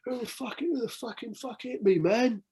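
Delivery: high-pitched indignant yell; high outraged mock voice. Mocking the angry guy in the rain, high yelling. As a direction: squeak it like a furious little man. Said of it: "the boy's like".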